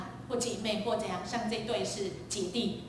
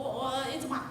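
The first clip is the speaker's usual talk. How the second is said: ordinary talk, nothing marked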